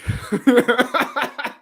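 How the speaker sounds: a short high burst of laughter; laughing. Real, not acted. Streamer laughs at his own plea to the internet, amused. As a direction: laugh it off after begging the internet. Pleading not to be cancelled.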